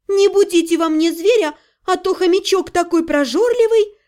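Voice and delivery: ordinary delivery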